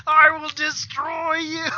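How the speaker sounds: high, slow mock villain threat; vengeful Goldar. Goldar avenges his broken toy, high slow mock threat. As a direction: draw out the threat in a hammed-up monster voice.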